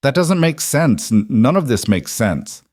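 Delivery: rising, barely controlled. A narrator's reading. Woman demands sense from rancher, voice rising. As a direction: let frustration lift the voice, then rein it in. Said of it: "fought to control it".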